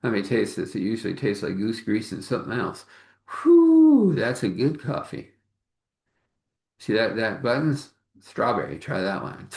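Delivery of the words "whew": a high, loud whew of delight; impressed whew. Acted. Tasting great coffee, a high loud impressed whew. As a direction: blow out a pleased whistle of surprise.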